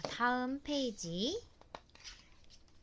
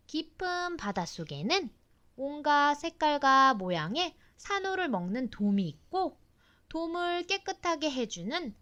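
put-on reading to a toddler; 3 semitones higher, 4 dB louder than usual. Mother reads book text aloud.

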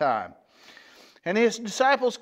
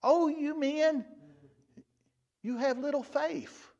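mild rebuke; 8 semitones higher, 3 dB quieter than usual. Retold or imitated. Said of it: "a little bit of a rebuke".

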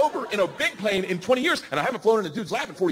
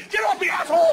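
high, loud acted-out shout; angry yell; 12 semitones higher, 5 dB louder than usual. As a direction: imitate someone fighting off a clinger. Cameraman kicking him off, acted.

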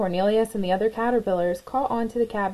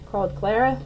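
usual speaking voice

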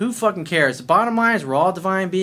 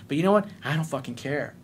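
ordinary talk, nothing marked

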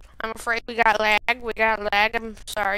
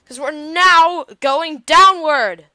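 loud, high and slow complaint; raised-voice exasperated announcement; 4 semitones higher, 11 dB louder than usual. Real, not acted. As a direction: stretch it out loudly in exasperation. Rocket failing, exasperated outburst.

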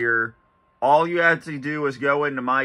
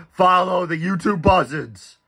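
higher, eerie preacher tone; lightly voiced sinister cult leader; creepy cult leader voice; 5 semitones higher than usual. Repeating Bray Wyatt catchphrase.

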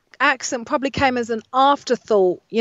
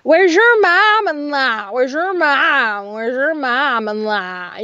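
put-on slow, drawn-out Exeter accent; broad West Country imitation, long drawn vowels; 2 semitones higher, much slower than usual. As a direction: imitate an Exeter man, stretching each word. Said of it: "I think he was from Exeter".

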